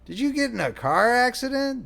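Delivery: put-on high, innocent child's question; innocent little boy. A narrator's reading. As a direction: ask with a small boy's innocence. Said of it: "the boy asked innocently".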